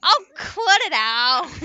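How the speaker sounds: playful shout. Real, not acted. Playful protest at teasing friend.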